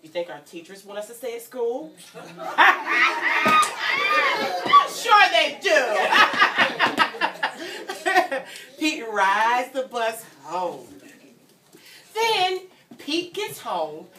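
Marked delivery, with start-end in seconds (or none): 2.1-8.4 laughing. Real, not acted. Joking teachers want kids.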